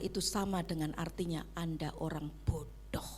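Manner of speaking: bisikan lembut; whisper. Real, not acted. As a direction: bisikkan sindiran ke jemaat. Whispered remark to listeners.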